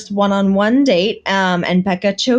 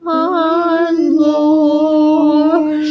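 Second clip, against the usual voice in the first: mock preacher; 9 semitones higher, much slower than usual. Mock pious praise, joking.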